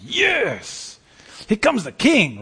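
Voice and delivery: high, thrilled cheer; excited. Retold or imitated. Preacher voicing listeners' excitement at the Lion, excited cheer. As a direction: greet the king like a fan. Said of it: "And you're like".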